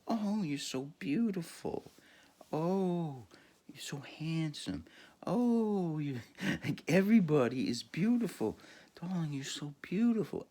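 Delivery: mock sugary darling voice, lifted high; gushing. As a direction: coo every compliment way up high. Imitating woman gushing compliments.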